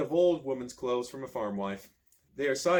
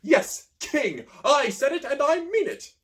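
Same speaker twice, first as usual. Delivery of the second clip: much higher, loud ranting prince; defiant outburst; 12 semitones higher, 7 dB louder, slower than usual. A narrator's reading. Prince John defiantly proclaiming himself king, high and loud. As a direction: hurl it at the whole hall.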